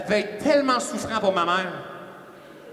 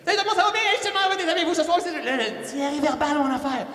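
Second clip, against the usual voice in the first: fast, high, laughing rush of words; rushed nervous greeting; 8 semitones higher, faster than usual. Retold or imitated. Speaker mimics his rushed, babbling opening to the audience, fast. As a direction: gabble the hello, nervous and hyped. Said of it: "Moi, je parle vite un petit peu".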